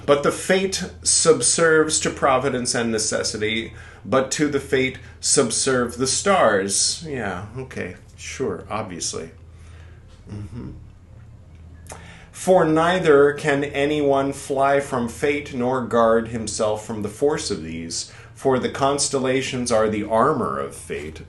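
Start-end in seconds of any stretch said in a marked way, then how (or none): 0.0-7.0 put-on deliberate formal reading of the old text; solemn text reading. Reads scripture aloud. 12.3-21.2 slow, higher and louder grand recitation; solemn slow reading. Acted. Reads scripture aloud.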